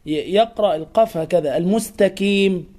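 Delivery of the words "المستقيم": "المستقيم" said al-mustakim; qaf as kaf. Shown as a demonstration. Teacher mimics faulty readers, k in "المستقيم". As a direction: swap the q of "المستقيم" for k. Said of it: "أن يقرأها كافا".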